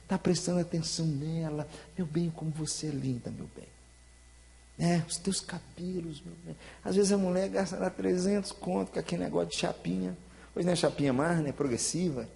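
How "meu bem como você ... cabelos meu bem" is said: soft, hushed lover's murmur; tender murmur. Acted. Husband softly sweet-talking his wife, tender murmur. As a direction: coo it to her like a doting husband.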